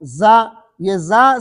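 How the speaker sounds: زا stretched very slowly with a long alif; drawn-out letter. Shown as a demonstration. Teacher modelling the letter for students, a slow drawn-out زا. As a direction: model زا slowly, holding the vowel. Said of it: "اس کے بعد ہے زا".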